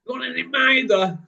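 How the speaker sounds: high, Mancunian-style got any mither; imitating Frank. Imitating his mate Frank's Salford accent for Ryan. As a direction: do your mate's Salford way of saying mither. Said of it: "That's what my mate Frank says".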